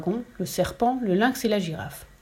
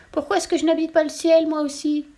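piglet voice; 8 semitones higher, 3 dB louder than usual. A narrator's reading. Piglet voice in story.

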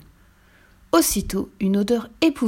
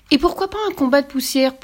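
playful father, raised pitch; exclaiming; 7 semitones higher, 3 dB louder than usual. A narrator's reading. Father topping the joke at breakfast, exclaiming. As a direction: exclaim it as a lively suggestion. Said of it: "s'exclama le papa de Pierre".

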